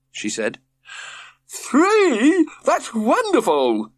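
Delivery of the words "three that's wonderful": very high, loud, delighted cry; overjoyed, loud shout; enthusiastic shout. A narrator's reading. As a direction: cheer at the news. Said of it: "shouted George enthusiastically".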